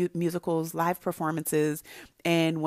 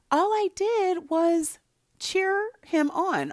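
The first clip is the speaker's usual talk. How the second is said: high, slow sing-song; 10 semitones higher, slower than usual. Real, not acted. Telling listeners how she cheered her husband, high and slow. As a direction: lift it way up and stretch it out.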